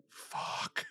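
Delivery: imitated whispered curse; hushed swearing. Retelling friend's look during the take, whispered swearing. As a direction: whisper the curse under your breath.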